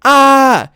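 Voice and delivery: high, loud mock scream "Ah!"; high imitated yelp of fright. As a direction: let out a startled kid's shriek. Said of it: "I was like".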